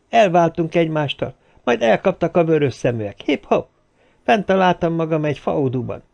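chatty man spinning his yarn; Sörhoff recounting. A narrator's reading. Voices Sörhoff telling his story.

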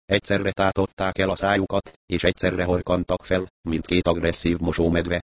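ordinary delivery

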